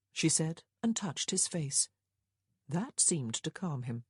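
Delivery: ordinary delivery, nothing marked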